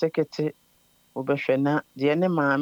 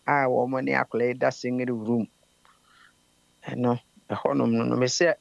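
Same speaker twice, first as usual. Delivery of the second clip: whispered, lower aside; 3 semitones lower than usual. Real, not acted. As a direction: hush the voice for the delicate part. Hushed detail about children's room.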